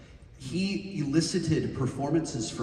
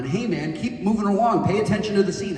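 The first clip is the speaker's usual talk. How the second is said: loud, higher-pitched brusque order; imitated lesser director; 8 semitones higher, 7 dB louder than usual. Lesser director scolding child actor in passing, mock loud order. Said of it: "a lesser director would have come in".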